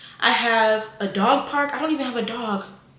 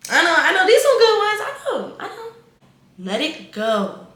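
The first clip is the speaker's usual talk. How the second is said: much higher and louder, a fake sob on good ones; mock crying; 9 semitones higher, 6 dB louder than usual. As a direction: ham up a weepy breakdown. Overcome by her own good tips.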